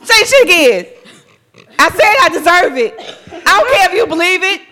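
loud, high, fierce comeback; loud high defiant retort with a laugh; defiant inner shout. Retold or imitated. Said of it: "My brain will be like".

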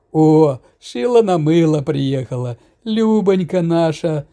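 slow, tipsy, fond greeting; drunken tenderness. A narrator's reading. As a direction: greet her with drunken affection. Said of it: "с пьяной ласковостью обрадовался".

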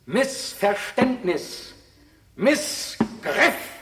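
slow high litany. Acted. Closing litany of words.

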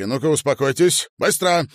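loud, high, forceful command; stern order. A narrator's reading. Man gripping crying woman's shoulders, commanding. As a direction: order it sharply at full voice.

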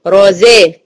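"رزا" as dark "ro" then thin "zeh"; heavy ra, light zay. Shown as a demonstration. Teacher models contrast, "رزا" heavy then light. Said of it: "الر هنا مفخمة والزين مرققة".

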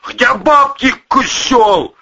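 high, loud angry shout; furious demand. A narrator's reading. Boss demanding his lost money, furious. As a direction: yell it as an accusation.

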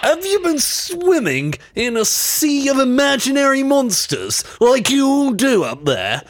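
high-pitched mocking tirade; hammed-up rant. Ranting at Scottish caller.

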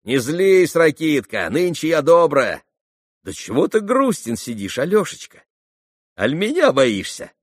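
высоко, громко, игриво; ласково поддразни робкого гостя; весёлая насмешка. Acted. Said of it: "С весёлою насмешкой заглянула". (задорно, с ласковой насмешкой)

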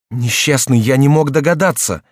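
dramatic outcry; crying out. A narrator's reading. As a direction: cry it out in alarmed realisation. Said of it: "вскрикнул Генрих".

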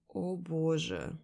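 long slow hungover moan; drawn-out groan. A narrator's reading. Waking from heavy sleep, hungover, slow groan. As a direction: drag the words out in a groan. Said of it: "Протяжно стану".

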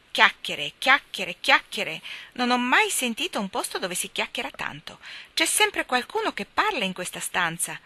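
the starling at the window, peevish and loud; petulant. A narrator's reading. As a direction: grumble at all the chatter. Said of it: "disse una voce petulante".

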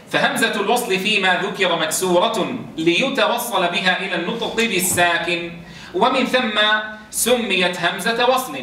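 usual speaking voice

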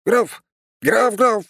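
very high, loud little yelp; yelling treant child. A narrator's reading. Little treant argues with father, high yelling. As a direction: yelp the one word high and sharp. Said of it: "заорал древень".

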